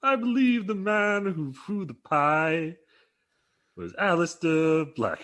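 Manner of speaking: high, slow, drawn-out wrestler voice; slow booming mock-formal address; Keith Lee impression. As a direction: stretch every word out grandly like Keith Lee. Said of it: "And then Keith Lee goes".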